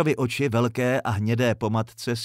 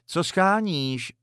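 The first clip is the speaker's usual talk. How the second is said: lightly called, higher and drawn out; calling out; 7 semitones higher, slower than usual. A narrator's reading. Woman calling from the window to a visitor, calling out. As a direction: call down to the visitor, slowly, pitched up. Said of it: "zavolala Madla".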